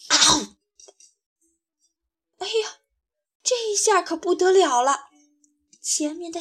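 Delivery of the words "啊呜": loud roaring "awoo"; lion roar. Acted. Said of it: "就张大嘴巴一声吼". (响亮的狮子吼声)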